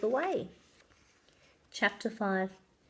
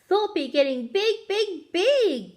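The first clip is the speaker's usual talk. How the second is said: put-on excited child's cry; 6 semitones higher, 3 dB louder than usual. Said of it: "cries Annie".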